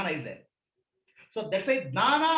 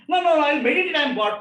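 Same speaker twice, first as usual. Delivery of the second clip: louder, stubborn mock of a seeker insisting; hammed-up protest, pushing the words out; mock insistence; 4 semitones higher, 7 dB louder, faster than usual. Teacher mocking a seeker who forces belief, loud mock insistence.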